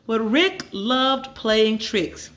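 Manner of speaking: plain reading voice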